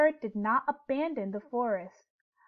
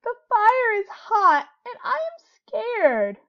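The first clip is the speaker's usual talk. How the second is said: high, loud, slow tearful cry; a scared rabbit weeping through the words; crying rabbit voice, pitched up; sobbing; 10 semitones higher, 8 dB louder than usual. A narrator's reading. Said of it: "sobbed Rabbit".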